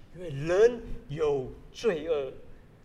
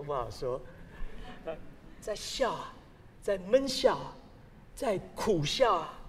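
ordinary talk, nothing marked

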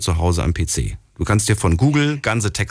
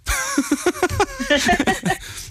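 high laugh. Real, not acted. Host laughs at own joke.

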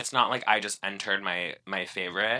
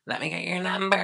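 flirty quote; 10 semitones higher, faster than usual. Retold or imitated. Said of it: "post-kiss been like".

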